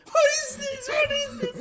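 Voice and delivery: very high, loud falsetto squeal; falsetto freak-out. Retold or imitated. Gamer mimicking himself seeing the trailer, falsetto freak-out. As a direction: shriek it up in head voice, hammed-up. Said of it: "I'm in a falsetto going".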